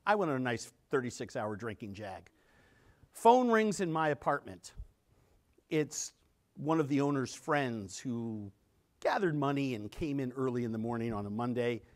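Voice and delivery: usual speaking voice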